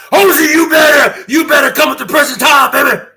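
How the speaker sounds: explosive high, loud demand; sudden shouting. Shown as a demonstration. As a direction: burst into a full shout to show your range. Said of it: "So I can go from here to here".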